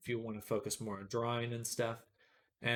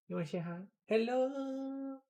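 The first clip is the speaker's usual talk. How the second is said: cat talk; 13 semitones higher than usual. Real, not acted. Streamer urging her cat to say hi, high baby talk. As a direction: coax the cat to greet viewers.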